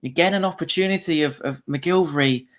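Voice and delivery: excited live match commentary; live play-by-play. Acted.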